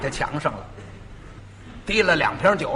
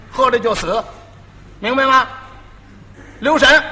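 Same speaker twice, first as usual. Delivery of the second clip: a master's firm, rising warning, louder by the end; stern warning; 4 semitones higher, 3 dB louder than usual. Retold or imitated. Master warns the apprentice not to touch the bottles, stern and loud. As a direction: drive the warning home, louder each phrase.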